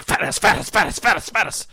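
lightly voiced creepy chant of "Fattest"; mock the voice in the static; imitating static voice. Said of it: "It was just like".

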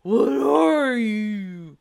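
very drawn-out mock man voice; Mister Biggs. Quizzing the caller, slow put-on man voice. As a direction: stretch the question, loud and manly. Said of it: "This is Mister Biggs".

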